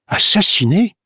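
high, rising incredulous question; shocked echo. A narrator's reading. Catherine hearing of the murder, shocked. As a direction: echo it in disbelief.

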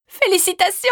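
high, joyful shout; excited cry. A narrator's reading. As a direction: call out congratulations with delight. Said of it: "cria-t-elle".

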